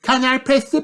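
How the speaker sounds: put-on very high child piglet asking; high-pitched eager child character; Peppa. A narrator's reading. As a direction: ask in a high little-pig voice. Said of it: "Peppa asks".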